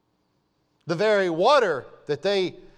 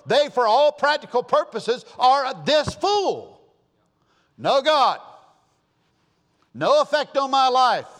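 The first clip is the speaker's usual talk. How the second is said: higher, louder emphatic preaching; preaching climax; 6 semitones higher, 4 dB louder than usual. Acted. Preacher condemning practical atheists, rising loud preaching. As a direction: hammer each phrase home.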